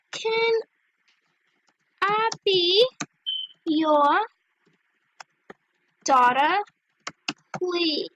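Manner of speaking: halting, one word at a time; typing aloud. Real, not acted. Asking strangers to be their sister, typing aloud. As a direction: voice each word as you type it. Spelling out her chat message.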